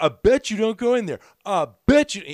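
high, loud taunt; taunting yell. Retold or imitated. Biker daring the comic, high and loud. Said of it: "He's like".

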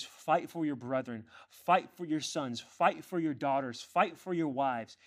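loud, measured battle cry; rallying exhortation. Acted. Preacher quoting the call to fight for family, rousing. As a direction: drive each phrase home like a rally.

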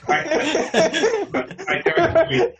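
laughing real protest. Real, not acted. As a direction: blurt it out through laughter. Laughing denial, repeated.

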